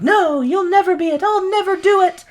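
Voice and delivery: squeaky high-pitched refusal. Retold or imitated. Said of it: "Deuteronomy's like".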